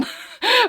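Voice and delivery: sudden high giggle; high burst of laughter. Real, not acted. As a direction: laugh out at yourself. Laughs at forgetting favorite character.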